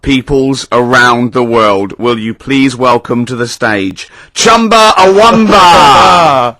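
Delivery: slow, loud announcer build-up; suspenseful awards presenter. Retold or imitated. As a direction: draw it out for a world audience.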